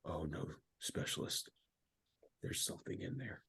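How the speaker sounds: faking a creeped-out whisper to spook a buddy; creepy whisper. Retold or imitated. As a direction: whisper it like you've seen a ghost. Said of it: "And I was like".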